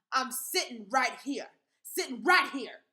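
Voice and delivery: loud, slow insistent shout; yelled. Acted. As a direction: yell it, refusing to budge. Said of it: "I yelled".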